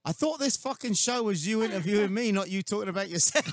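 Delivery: loud, high retold telling-off; mock complaint. Retells a guest's complaint to host.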